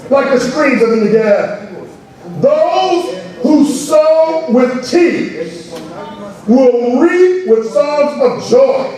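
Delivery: slow, rising psalm read into a half-sung line; preaching the psalm. Acted. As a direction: let the verses swell with fervor.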